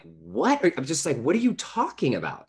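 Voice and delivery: baffled, as if the question makes no sense; incredulous. Retold or imitated. Stunned reaction to grandma's question.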